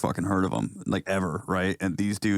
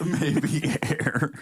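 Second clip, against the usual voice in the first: laughing; 7 semitones higher than usual. Real, not acted. Repeating the punchline while laughing.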